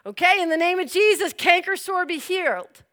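eager imitation of a boy praying; insistent healing prayer. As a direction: pray with renewed push.

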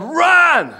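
high loud shout of Run; yelled order. Retold or imitated. Commanding officer yelling at firemen to flee, shout. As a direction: scream it over the explosions. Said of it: "he yelled".

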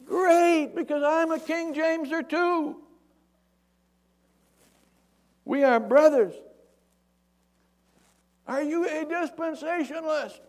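high, loud acted holler; sustained long-distance shout; yelling. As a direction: call up to a man far above. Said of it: "He yells up at him".

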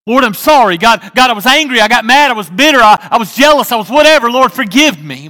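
mock repentant prayer, loud and high; loud pleading prayer. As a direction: cry out to God for forgiveness, full voice. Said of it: "voiced prayer of repentance".

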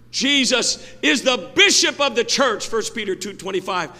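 high, loud pulpit proclamation; preaching. Real, not acted. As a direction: proclaim it loudly to the congregation. Preaches at full force.

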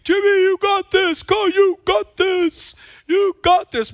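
high, loud, stretched cheer; yelling encouragement. Retold or imitated. Mom and grandma yelling at Timmy, high loud cheer. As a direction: yell from the bleachers. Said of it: "Grandma and Mom started yelling".

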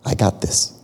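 soft, low, half-whispered reassurance; a softly voiced God comforting; calm God. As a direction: quietly promise you've got it. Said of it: "He goes".

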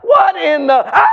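hammed-up startled crowd; mock shock. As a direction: break off into a comic scream. Acts crowd shocked by rising.

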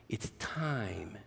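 whispered secret; whispered refrain for the children. A narrator's reading. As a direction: breathe it out as a whisper. Animals softly sing the refrain.

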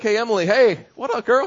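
high, loud, breezy mock bro greeting; husband casually greeting his wife after ten years. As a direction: toss it off like greeting a buddy. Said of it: "I'm like".

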